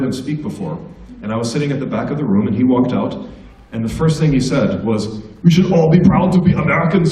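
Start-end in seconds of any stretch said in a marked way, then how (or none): 5.4-7.1 imitating the ambassador's Austrian accent, louder and higher; mock Austrian ambassador voice. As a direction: stiff Austrian-accented announcement to the room. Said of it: "never quite lost his Austrian accent".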